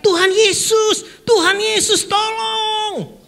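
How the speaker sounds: jeritan tinggi ketakutan; teriak minta tolong. Acted. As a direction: berteriak minta tolong dengan panik. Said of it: "dia teriak". (jeritan ketakutan minta tolong)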